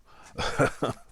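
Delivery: relieved laugh. Real, not acted. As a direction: burst of laughter. Relief after a scare.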